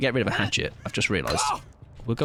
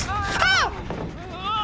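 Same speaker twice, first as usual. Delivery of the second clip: high-pitched startled yelp; squealing in fright; startled scream. Real, not acted. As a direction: let out a sudden high "ah". Startled mid-game.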